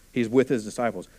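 ordinary talk, nothing marked

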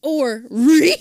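high ree squeal; laughing squeal. Pretend. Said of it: "horn sound".

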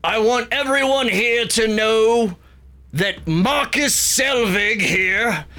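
big, booming god proclaiming to all. Acted. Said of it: "booming voice says".

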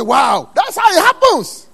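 high, loud, drawn-out shout of wonder; hammed-up astonished holler; amazed exclamation. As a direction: cry out in acted amazement. Acts out amazed believer's cry.

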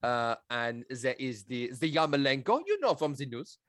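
put-on lightly done French accent, questioning lilt; a hint of a French scout, every word a question; French accent impression. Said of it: "classic French impression".

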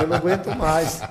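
jokingly acting out a desperate grin; smiling through it. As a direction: say it through a forced smile and laughter. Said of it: "sorrindo assim, ó".

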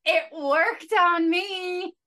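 slow words through tears of laughter; laughing till crying. Real, not acted. Remembering her teen crush, laughing so hard she cries. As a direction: let the laugh crack the words. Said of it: "I'm laughing so hard I'm crying".

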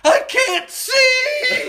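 high, slow, laughing mock wail; panicked wail. Injured boy to his dad, mock panicked wail. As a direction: wail it like a terrified kid. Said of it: "And I'm like".

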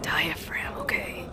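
breathy hushed whisper. Acted. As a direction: drop to a whisper. Whispered word game.

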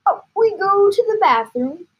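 high, loud rallying cry; eager father calling out; excited call. Acted. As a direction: lead the charge with gusto. Excited father calling son upstairs.

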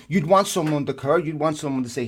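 ordinary talk

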